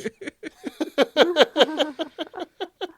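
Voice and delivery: big burst of laughter; loud laugh. Real, not acted. Laughing hard at the messy character art. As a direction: crack up loudly at the picture.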